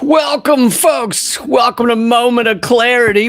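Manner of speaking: big booming host welcome; loud show opener. Real, not acted. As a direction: shout the greeting like opening a show. Show host's loud opening greeting.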